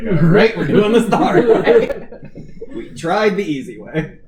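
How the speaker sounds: loud, half-laughing threat; mock tough, laughing. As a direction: play it tough through giggles. Character loses patience, laughing.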